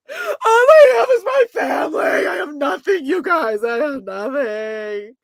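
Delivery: high, loud hammed-up sobbing yell; mock crying shout. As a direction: wail it like a melodramatic breakdown. Mocking Jen's breakdown.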